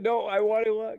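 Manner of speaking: whiny protest. Pretend. Mock dying player's protest.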